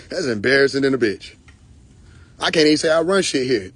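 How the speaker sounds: usual speaking voice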